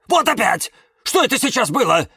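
loud, high, agitated exclamation; exclaiming. A narrator's reading. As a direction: demand an answer, startled and urgent. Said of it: "воскликнул Кирилл".

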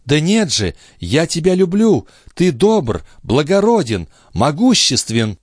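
high-pitched, fervent declaration of love; adoring exclamation. A narrator's reading. Lorenza assures the count of her love, adoring and exclamatory. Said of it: "воскликнула она".

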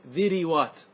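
ذروات as dhi-ri-wat; three syllables in ذروات, "ri" in the middle; heavy kasra before waw. Shown as a demonstration. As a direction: sound an "i" after the ر in ذروات. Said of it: "الكسرة قبل الواو ثقيلة".

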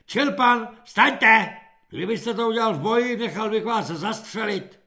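high, loud berating; angry threatening. A narrator's reading. Corporal threatening to have recruit shot, berating.

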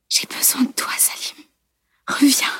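soft, whispered plea; hushed, intimate appeal; murmured. A narrator's reading. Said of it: "Elle avait murmuré".

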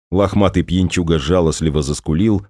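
plain reading voice

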